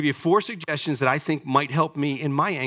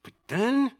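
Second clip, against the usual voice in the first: normal talking voice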